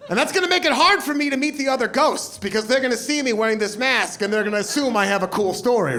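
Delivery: ordinary talk, nothing marked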